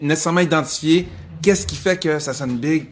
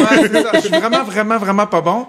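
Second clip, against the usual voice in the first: laughing; 7 semitones higher, 7 dB louder than usual. Real, not acted. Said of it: "C'est peut-être mieux que tu le fasses pas".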